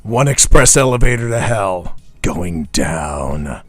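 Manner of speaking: deep, slow action movie growl; gruff eighties action hero line. Shown as a demonstration. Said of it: "do your best deep act, uh, deep, deep voice action hero voice".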